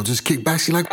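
usual speaking voice

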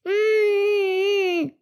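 hurt little whimper through closed lips; sulky whine, near tears; whiny sulk. Acted. Daughter sulks again.

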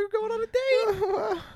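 very high, drawn-out giddy squeal; mock happy squeal. Imitating a woman thrilled at the drive-thru date, happy squeal. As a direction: squeal it slowly, hamming up the delight. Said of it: "she's so happy".

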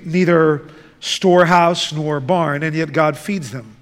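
ordinary talk, nothing marked

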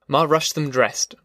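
ordinary delivery, nothing marked

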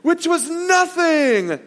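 a preacher mocked while telling a parable; imitating the preacher. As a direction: stretch it out like a preacher brushing it off. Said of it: "a lot of times the preacher will say".

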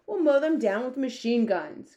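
loud, high military bluster; forceful cry. A narrator's reading. Army head urges machine guns, crying out loudly. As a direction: cry out an attack plan. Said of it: "cried the head of the army".

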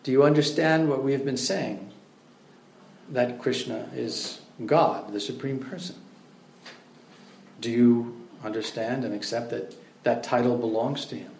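calmly pin the student down. Retold or imitated. Prabhupada calmly questions the student in morning class, quiet. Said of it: "looks at him very calmly and says".

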